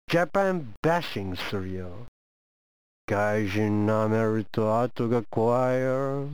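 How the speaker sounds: a hint of a sinister foreigner; mock threat. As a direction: warn them darkly but with a wink. Threatening Japan if it stays closed.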